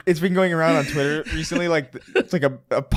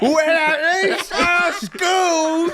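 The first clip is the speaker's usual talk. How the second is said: high, slow, shouted mock American; 12 semitones higher, slower than usual. Mocking an American reply to British, high slow shout. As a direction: proclaim it high and slow like a hammed-up Yank.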